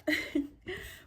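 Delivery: high giggle; bright excited laugh. Real, not acted. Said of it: "sorry, I'm excited".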